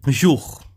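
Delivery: "Jour" with oo and throaty French r; French ou sound. Shown as a demonstration. Teacher showing the ou sound, French pronunciation. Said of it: "dá o som de jour".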